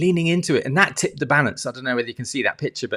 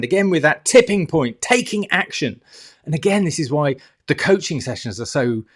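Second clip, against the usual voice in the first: ordinary delivery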